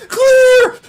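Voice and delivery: loud, high, drawn-out shout; paramedic's yell. Acted. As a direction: yell a warning before the defibrillator shock. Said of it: "a paramedic yelling".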